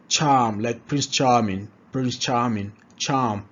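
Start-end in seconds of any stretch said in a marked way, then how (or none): none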